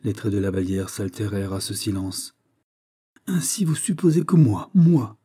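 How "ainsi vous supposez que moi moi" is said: raised, louder voice of wounded disbelief; high, affronted outburst; indignant protest. A narrator's reading. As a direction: protest as if wrongly accused. Accused young woman protests to king.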